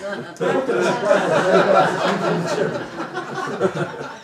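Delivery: loud, long, helpless laugh; loud burst of laughter. Real, not acted. Pastor laughing loudly at the friend's McDonald's celebration. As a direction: crack up loudly.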